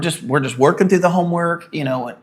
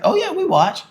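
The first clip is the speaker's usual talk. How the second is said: mock casual guy; 3 dB louder than usual. Imitating men confirming.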